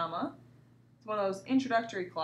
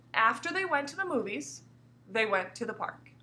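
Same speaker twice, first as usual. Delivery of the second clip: short pause after After they went to the movies. Shown as a demonstration. Teacher reading aloud so students hear the pause. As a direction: break the sentence at movies. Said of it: "Where did my voice kind of pause?".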